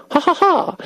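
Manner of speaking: high, voiced "ha ha ha"; read-out laugh. DJ reading a listener's message, high mock laugh. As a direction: act out a typed laugh, pitched high.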